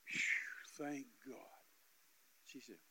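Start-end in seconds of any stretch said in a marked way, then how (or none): 0.0-1.3 hushed, breath-heavy relief; acted sigh of relief. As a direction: blow out a breath, then whisper. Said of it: "I literally did like this".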